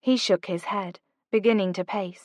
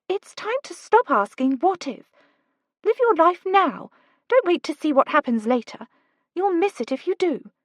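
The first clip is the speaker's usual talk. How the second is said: put-on lightly higher, urgent young woman; Kate's voice; 9 semitones higher than usual. A narrator's reading. Kate urging Oliver to go, lightly higher voice. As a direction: push him, brisk and bright.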